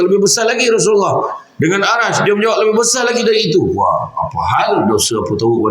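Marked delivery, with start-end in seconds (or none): 0.0-3.6 higher, pleading youth's voice; preacher voicing the youth, higher and urgent; anguished confession. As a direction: cry out that the sin is bigger still.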